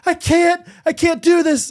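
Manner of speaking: high, loud cry of despair; desperate protest. Retold or imitated. Wife in labour protesting, high and loud. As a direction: plead that it's too much. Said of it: "And she's like".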